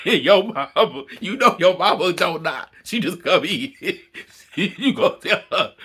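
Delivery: loud, high, giggly; laughing. Real, not acted. Host telling the daughter her mama never knocks, giggling. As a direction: talk while still laughing. Still laughing about the mom.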